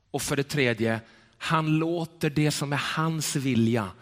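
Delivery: usual speaking voice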